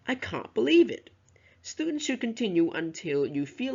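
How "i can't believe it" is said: CAN'T, BELIEVE, IT said louder and higher; louder stressed words. Shown as a demonstration. Teacher shows how students should read it, stressed words louder. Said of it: "speak louder on those three words".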